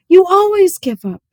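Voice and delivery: a high, loud shout. Acted. Opal shouting at her daddy in the rain, high and loud. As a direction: yell it at him, furious. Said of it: "I shouted".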